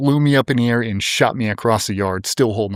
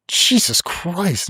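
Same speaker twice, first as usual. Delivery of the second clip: high, drawn-out disbelief; retold appalled outburst, slow and high; shocked exclamation; 8 semitones higher, much slower than usual. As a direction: stretch it out, pitched up in alarm. Retold shock at nurse's remark.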